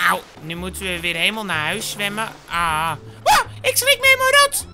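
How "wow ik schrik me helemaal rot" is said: high, loud cry of fright; startled shriek. Acted. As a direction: shriek as if scared out of your skin. Startled while swimming.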